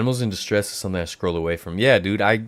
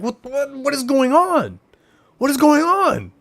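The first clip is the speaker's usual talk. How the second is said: alarmed disbelief; 3 dB louder than usual. Retold or imitated. Driver startled by chipmunks darting out, high alarmed disbelief. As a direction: replay the panic as they dart across the road.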